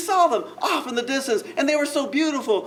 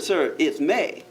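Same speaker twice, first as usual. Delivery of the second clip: slow disbelief; 6 semitones lower, much slower than usual. Retold or imitated. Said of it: "I said".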